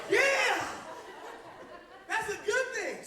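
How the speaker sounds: put-on light up like a kid; excited. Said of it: "And I was like".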